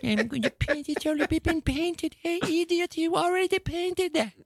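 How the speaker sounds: mocking rival painters. Monet mocking painters who copy the classics, high and sneering. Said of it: "mocking other painters".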